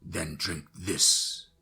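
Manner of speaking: very slow, menacing command; ominous vampire voice. Acted. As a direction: draw it out darkly. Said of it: "he said".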